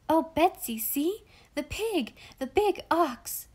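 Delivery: put-on high-pitched little-girl excitement; thrilled child calling out; excited cry. A narrator's reading. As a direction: cry out in wonder, high and eager. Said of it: "cried Molly now".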